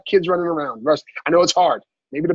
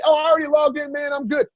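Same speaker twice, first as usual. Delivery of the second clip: higher-pitched, lightly voiced know-it-all client; sly individual; 7 semitones higher than usual. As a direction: brush the coach off in a higher voice. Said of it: "the sly individual that say".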